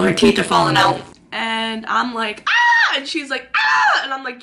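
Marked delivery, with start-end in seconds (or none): 2.5-3.0 short high-pitched shriek; sudden loud, very high scream; retold scream. Friend screaming at injured friend, short shriek. 3.6-4.0 loud, piercing high shriek; retold scream. Injured friend screaming back, high shriek. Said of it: "Don't scream, don't scream".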